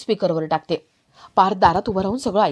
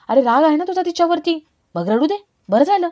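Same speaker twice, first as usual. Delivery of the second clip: pitched up, spiteful satisfaction; gloating mother; 6 semitones higher than usual. Acted. Mother-in-law gloating to son, spitefully pleased.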